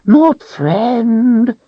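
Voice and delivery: high whining creature voice; creature whine. A narrator's reading. Creature whining to Richard about the Calthrop. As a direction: whine it out, cringing. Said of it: "it whined".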